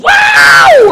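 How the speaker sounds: sudden very high, loud whoop; amazed whoop. Retold or imitated. Mocking the younger guy's bathroom power with an amazed whoop. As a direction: whoop like you're stunned by the force. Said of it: "it's like".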